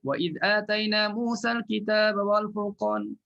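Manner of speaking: chanted, repeating from the start; tajwid recitation. Shown as a demonstration. Ustaz showing the restart, chanted. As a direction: go back and recite it through. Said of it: "Lepas tu dia ulang balik".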